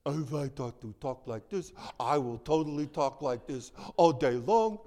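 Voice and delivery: put-on high, loud baby-talk grandparent voice; doting grandparent. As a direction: gush like a grandparent cooing at a baby.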